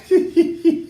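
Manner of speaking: short high-pitched giggle; amused giggle. Real, not acted. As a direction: giggle at your own remark. Laughs at own emphatic correction.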